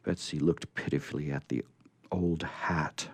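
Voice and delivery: ordinary delivery, nothing marked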